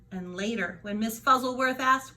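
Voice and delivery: usual speaking voice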